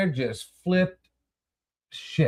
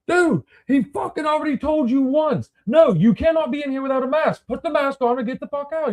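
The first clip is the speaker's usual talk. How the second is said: retold angry outburst; 13 semitones higher than usual. Telling off the maskless customer, a retold angry yell. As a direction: replay the blowup, pitched up and hot. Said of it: "now I'm pissed".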